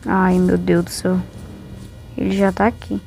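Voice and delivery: ordinary delivery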